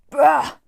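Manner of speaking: loud, high disgusted bark; angry bah. Acted. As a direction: blurt out frustration. Wolfhead frustrated outburst.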